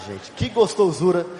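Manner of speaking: ordinary delivery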